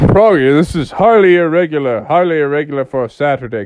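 lightly voiced scolding father frog; loud, put-on fatherly complaint; stern dad. Dad woken on a Saturday, lightly voiced scolding.

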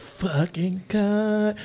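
drawn-out mock insult.

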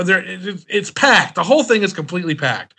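ordinary talk, nothing marked

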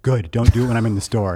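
a lightly voiced strict mom; stern order. Mom laying down the rule.

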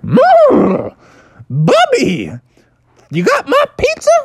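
very high, loud whinnying creature voice; Mandy the Unicorn. Acted. Unicorn greets Bubby at the door, high squealing voice. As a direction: squeal it out high like a cartoon animal.

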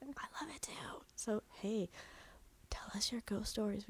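soft whispered plea. Real, not acted. Host whispers to listeners, asking for their stories. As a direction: whisper it to the audience.

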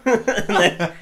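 normal talking voice

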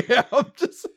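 high laughter through a yeah; laughing. Real, not acted. Host cracking up at cohost's neck joke.

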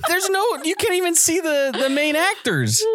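very high-pitched, baffled protest; incredulous. Real, not acted. Arguing with friends over where the clip came from, incredulous. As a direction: squeal it in total disbelief.

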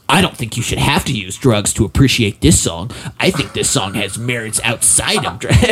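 put-on voice of an earnest teen; mock your younger self's stubborn protest; adamant fifteen-year-old self. Man imitating his teenage self in YouTube comments, adamant. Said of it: "being adamantly like".

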